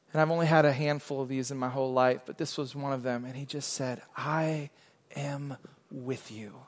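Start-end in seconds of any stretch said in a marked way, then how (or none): 4.2-6.5 slow, soft, reverent hush; hushed voice of the Lord. Retold or imitated. Pastor voicing God's quiet word to him, hushed and slow. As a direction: let each word land gently, almost whispered.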